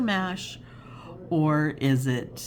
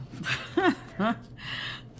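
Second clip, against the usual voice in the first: giggle; 8 semitones higher, 4 dB quieter than usual. Real, not acted. Laughing at herself.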